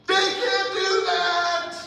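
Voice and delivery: drawn-out, high-pitched holler; outraged spectator yell. Retold or imitated. Basketball parents protesting a rough play, outraged yell. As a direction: bellow it slowly like a protesting fan. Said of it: "And then this follows".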